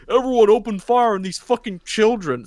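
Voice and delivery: generic villain ordering his men to fire on children, in a hammed-up cartoon voice; cartoon baddie. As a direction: bark it like a Saturday-morning bad guy. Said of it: "generic cartoon baddie".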